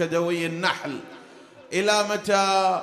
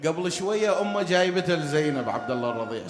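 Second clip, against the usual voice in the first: ordinary talk, nothing marked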